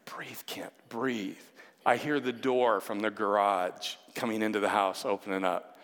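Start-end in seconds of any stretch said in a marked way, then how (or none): 0.0-1.4 softly whispered to himself; calm yourself down in a whisper; whispered self-talk. Retold or imitated. Nervous man hearing the garage door, whispering to himself.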